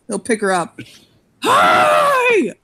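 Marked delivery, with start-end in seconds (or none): 1.4-2.5 very high, loud squealed hello; exuberant greeting. Acted. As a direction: shout a delighted greeting way up high. Character greets after picking her up.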